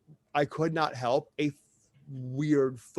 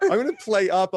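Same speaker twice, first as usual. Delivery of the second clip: playful excitement; 6 semitones higher, 8 dB louder than usual. Real, not acted. Laughing about flirting online.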